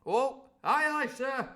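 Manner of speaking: loud high answering call; cook's cry. Acted. The cook answering the Squire, a loud high cry. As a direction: call it back from the passage. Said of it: "cried the cook in the passage".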